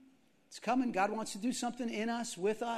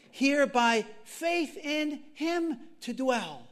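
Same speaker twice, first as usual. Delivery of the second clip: slow, emphatic declaimed verse; hymn recitation; 5 dB louder, slower than usual. Acted. Preacher recalls the hymn for the congregation, slow declaiming. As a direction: declaim each phrase with long pauses.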